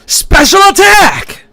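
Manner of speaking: high, slow battle cry; special attack shout. Acted. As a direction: yell the move name like a fighter. Game character calling attack.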